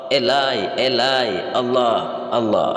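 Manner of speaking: drawn-out, evenly spaced cries, not tearful; measured demonstration of a cry to God; calling out to God. Shown as a demonstration. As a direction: call each name with a long falling vowel. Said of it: "Dia menangis kepada Allah".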